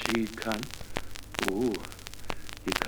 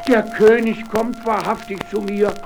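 excited; 6 semitones higher than usual. Acted. Miller sees the king coming.

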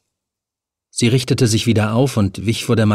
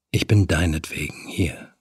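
low, soft, almost seductive; soft murmur; 5 semitones lower, 6 dB quieter than usual. A narrator's reading. The hook man to Helen, softly, almost seductive murmur. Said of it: "murmelte er so sanft".

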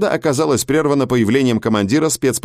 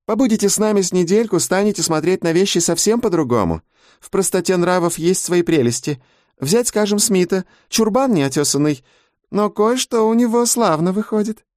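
high-pitched female character voice; chatty lady ape, approving; 9 semitones higher than usual. A narrator's reading. Mrs Jenkins reassures the newcomer about cage life, chatty and high. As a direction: pitch it well up for a sociable matron.

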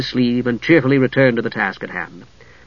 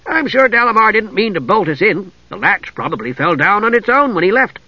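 higher-pitched, lively kender voice; eager kender; 7 semitones higher than usual. A narrator's reading. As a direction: play a chirpy, restless kender. Tass speaks, unworried.

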